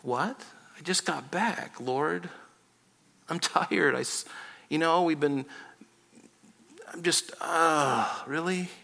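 soft, tired protest with halting pauses; weary reluctance. Acted. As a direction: grumble quietly like a worn-out fisherman, sighing. Acts out weary Simon's reluctant reply.

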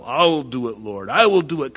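eager, boastful pledge with a rising push; bravado. Acted. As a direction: play Peter's cocky bravado, punching the second vow. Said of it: "in his bravado".

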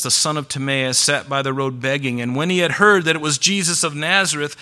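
ordinary talk, nothing marked

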